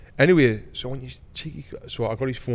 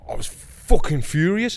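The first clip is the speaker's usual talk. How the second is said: angry; 6 semitones higher, 5 dB louder, slower than usual. Real, not acted. Angry retelling of confrontation.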